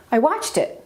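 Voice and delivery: "watched" stressed, pronouns unstressed. Shown as a demonstration. Teacher says it again for learners, stress on "watched". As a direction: hit "watched", let "it" fall away. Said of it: "I stressed watched".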